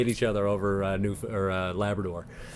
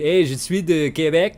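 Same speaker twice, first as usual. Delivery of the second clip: mock Quebecois "Hey, je suis de Québec" a bit higher and louder; a light Québécois accent, higher and louder; 5 semitones higher, 6 dB louder than usual. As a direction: play a proud Québécois announcing himself. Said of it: "On dit".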